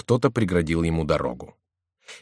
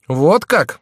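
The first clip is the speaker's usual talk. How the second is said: louder, higher angry retort; 9 semitones higher, 8 dB louder than usual. A narrator's reading. Insulted Мелитор answering back, angry. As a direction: flare up, indignant and raised. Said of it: "сказал разгневанный Мелитор".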